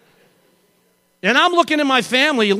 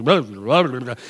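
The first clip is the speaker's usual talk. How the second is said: low imitated jumble of babble; dizzy babble; 9 semitones lower than usual. Dizzy rider trying to yell, jumbled low babble. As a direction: babble like a scrambled head. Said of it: "my head's like".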